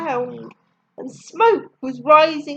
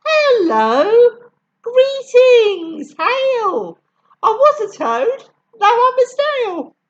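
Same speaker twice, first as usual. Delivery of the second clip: higher, louder grand hello; snail's greeting; 6 semitones higher, 5 dB louder than usual. A narrator's reading. Snail introduces himself to the boy, grandly higher and louder. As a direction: greet like a stately creature. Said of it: "The snail said".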